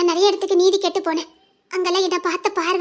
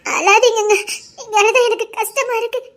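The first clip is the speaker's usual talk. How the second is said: tearful plea; 3 semitones higher than usual. Acted. Wife pleads with crying husband.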